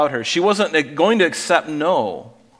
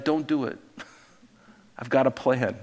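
soft, understated reply; calm divine reply; 6 dB quieter than usual. Acted. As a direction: play God quietly talking someone down. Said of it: "and he's like".

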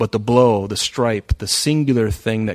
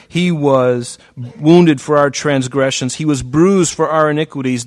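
impassioned scripture reading; 3 semitones higher, 7 dB louder than usual. Acted. Scripture read with preaching force.

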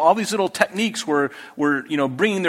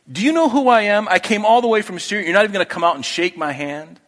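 furious Naaman; 5 semitones higher, faster than usual. Retold or imitated. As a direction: act out an offended commander's angry demand. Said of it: "Naaman was furious".

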